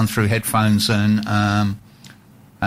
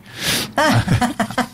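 high laugh at own joke; 13 semitones higher than usual. Real, not acted.

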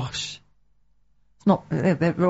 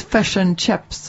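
slow New Zealand imitation, "Fish" like fesh; New Zealand accent; much slower than usual. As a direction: mimic a New Zealander, "chips" like cheps. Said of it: "He's from New Zealand".

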